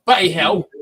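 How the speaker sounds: bad British accent. Shown as a demonstration. Host admitting his bad British accent to the guests. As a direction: overdo a clumsy British "Bloody hell". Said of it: "that's how bad I am right there".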